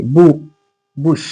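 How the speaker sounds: बु first, then the full बुश; sounding out bush. Shown as a demonstration. Teacher breaking down बुश for pupils. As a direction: split बुश into बु and the whole word. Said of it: "उ असं उच्चार दिसतंय".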